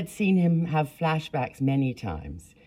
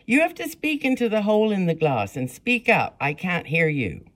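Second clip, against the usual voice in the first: higher, louder impatient order; demanding; 7 semitones higher, 4 dB louder than usual. A narrator's reading. Clerk orders the girl to speak up, high and loud. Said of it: "demanded the woman".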